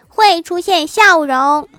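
high, loud, drawn-out reply; loud punchline answer. Acted. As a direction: belt out the answer slowly and proudly. Child's cheeky punchline answer, acted.

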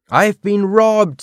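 high, slow, dragged-out shout of alarm; scream. A narrator's reading. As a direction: howl it as if the room were robbed bare. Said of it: "screamed Arthur".

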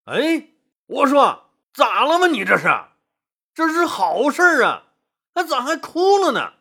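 high-pitched, baffled questioning; startled bewilderment. A narrator's reading. Liu Bang to his crying consort over good news, startled bewilderment. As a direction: pitch it way up, completely taken aback. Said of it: "刘邦大惊".

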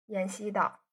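ordinary delivery, nothing marked

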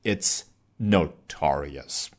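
slow notorious with the first syllable no lightly pushed; a teacher modelling the stressed no in notorious. Shown as a demonstration. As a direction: put a little weight on the no of notorious. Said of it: "if you put a slight stress on the first syllable".

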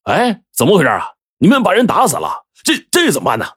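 mock alarm, pitch jumping up; feigned shock. A narrator's reading. Scheming chief pretending shock at the dead thief. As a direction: fake surprise at the body. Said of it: "就大吃一惊".

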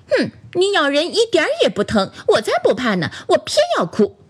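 put-on high, childish huffy retort; pouting defiance. A narrator's reading. Doll defies toothless rubber lion, high pouting retort. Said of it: "弟弟撇撇嘴说".

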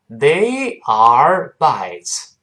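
"They are bites" very slow, every word stressed; absurdly over-careful. Shown as a demonstration. Teacher mocks reading every word fully, absurdly over-careful. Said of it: "这有病了".

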